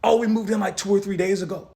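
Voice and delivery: flustered neighbor. Retold or imitated. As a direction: squeak out a flustered excuse. Voicing neighbor's answer.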